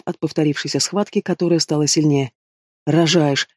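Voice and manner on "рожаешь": plain reading voice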